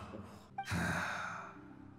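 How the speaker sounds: long unvoiced sorrowful sigh; deep mournful sigh; heavy sigh. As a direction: let out a weary breath. Ghost mourns his friend's burden.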